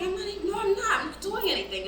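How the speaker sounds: high-pitched retold protest; acted-out indignant denial; defensive denial.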